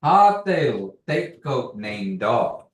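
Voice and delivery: Ha te lo te ko name dog said slowly and loud; slow model phrase. Shown as a demonstration. Teacher modeling a Kiowa phrase, slow and clear. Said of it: "one more time".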